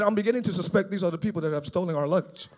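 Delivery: low, quick, deadpan prim prince; mock-proper understatement, fast and low; posh prince. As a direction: Akeem calmly noting stolen luggage, low prim voice. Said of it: "No, he said".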